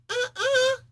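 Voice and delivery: very high cried "uh-uh"; a shrill no-you-don't cry; mock refusal cry. Namor grabs the fleeing hero, very high mock cry. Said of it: "Namor's like".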